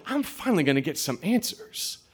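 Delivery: high, thin thrill of anticipation; hopeful high-pitched squeal to oneself; excited inner voice. Retold or imitated. Child thinking answers are finally coming, excited inner voice. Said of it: "But I'm also like".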